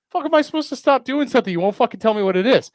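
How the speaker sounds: fast, high-pitched indignant rant; mock exasperation. Visitor demanding a definition, fast high mock exasperation. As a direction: rattle out a hammed-up protest. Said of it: "I'm like".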